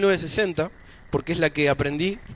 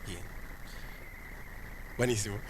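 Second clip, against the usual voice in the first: ordinary delivery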